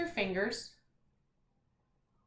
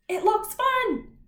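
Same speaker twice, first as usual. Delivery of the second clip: higher, louder and slow, "It looks fun" called out excitedly; exclamation reading; 11 semitones higher, 7 dB louder, slower than usual. Shown as a demonstration. Teacher demonstrating the excited version to the class. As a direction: give it the exclamation point. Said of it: "I'm gonna put an exclamation point".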